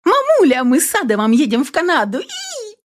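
put-on a teen bursting with happy news; excited daughter. A narrator's reading. Daughter squealing to mom about Canada, excited. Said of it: "Заскакала моя козочка".

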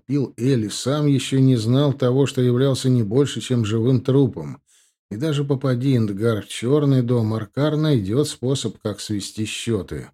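plain reading voice

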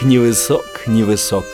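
ordinary delivery, nothing marked

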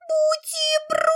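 very high squeaky creature voice; tiny squeak. A narrator's reading. Furry critter begs for supper: very high squeak. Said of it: "пропищал".

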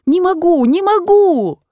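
put-on old aunt crying out to her niece; anguished cry. A narrator's reading. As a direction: cry it out, at the end of her rope. Aunt's outburst climaxes.

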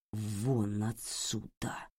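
barely audible fury; very quiet. Acted. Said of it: "Это было произнесено так тихо".

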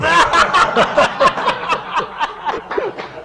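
high, helpless burst of laughter; loud laughter. Real, not acted. Friends laughing at the quintuplets joke, high burst of laughter. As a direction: crack up at the punchline.

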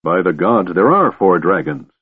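ordinary delivery

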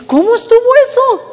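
very high, loud mock bewilderment; startled questioning. Preacher voicing a listener's doubt, startled questioning. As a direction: ask it high-pitched, imitating a baffled listener.

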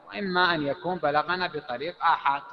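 ordinary talk, nothing marked

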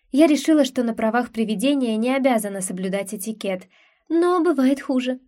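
ordinary delivery, nothing marked

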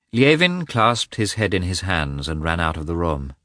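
ordinary delivery, nothing marked

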